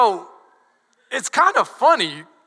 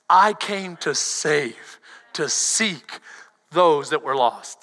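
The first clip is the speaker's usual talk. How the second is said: tender quote; 8 semitones lower, slower than usual. Acted. Jesus quoted softly, slowly.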